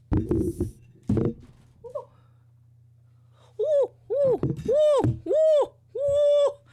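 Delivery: string of short, very high woos; high whoops. Real, not acted. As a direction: whoop repeatedly as something nearly topples. Startled whoops, almost spilled something.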